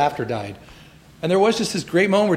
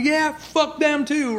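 much higher, slow, drawn-out mock rally taunt; Trump crowd-pleaser; 11 semitones higher, much slower than usual. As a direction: egg on a laughing crowd, hammed-up and stretched. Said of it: "being like".

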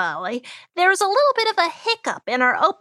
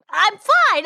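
louder, higher insistence through hiccups; insisting; 6 semitones higher, 4 dB louder than usual. Acted. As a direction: push it, you're not fine. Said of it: "I'm trying to tell you".

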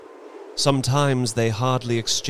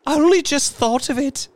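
very high, loud, fast outburst; hysterical; 5 dB louder, faster than usual. A narrator's reading. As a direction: blurt it out in a frenzy. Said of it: "she said hysterically".

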